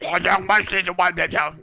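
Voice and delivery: hammed-up beer-bellied Eastern European official; mock a gruff bureaucrat's accented talk; beer-belly bureaucrat. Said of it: "beer belly bureaucrats come up to you".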